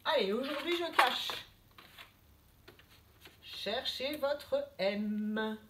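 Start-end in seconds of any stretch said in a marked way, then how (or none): 3.4-5.6 slow teacher prompt. Shown as a demonstration. Teacher guiding a letter hunt.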